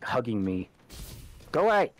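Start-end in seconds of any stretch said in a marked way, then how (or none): 1.5-1.9 sharp loud shout to shoo away. Real, not acted. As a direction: yell it out, pushing someone off. Bot teammate hugging her.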